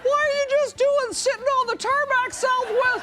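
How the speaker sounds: high squeaky cartoon voice. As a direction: voice it like an animated character. Voicing plane in Pixar style.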